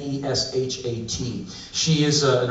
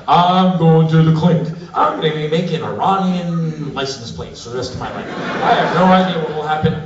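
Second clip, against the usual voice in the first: hammed-up doomed diary voice, laughing; mock diary entry; 4 semitones higher, 4 dB louder than usual. Traveler fearing prison, hammed-up diary lament. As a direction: write your last words dramatically.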